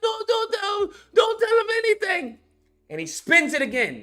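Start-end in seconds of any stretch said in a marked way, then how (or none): 0.0-2.4 high, loud, tearful begging; frantic pleading. Retold or imitated. Retelling the guy begging him not to tell, frantic plea. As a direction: imitate a desperate plea, pitched way up. Retold hostage pleads with captor.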